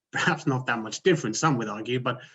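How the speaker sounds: ordinary talk, nothing marked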